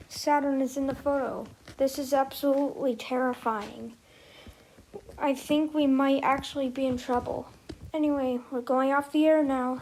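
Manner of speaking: ordinary delivery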